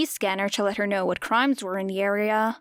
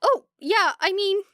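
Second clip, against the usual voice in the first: put-on higher, upbeat boy; bright; 11 semitones higher, 3 dB louder than usual. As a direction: cheerfully push for studying together. Midoriya starts to answer.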